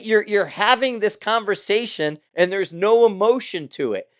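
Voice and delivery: usual speaking voice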